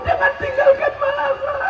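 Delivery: hammed-up high wail; very high, theatrical weeping voice; mock crying. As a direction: imitate a wife wailing for her husband. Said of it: "Menangis dia".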